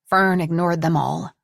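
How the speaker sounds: ordinary delivery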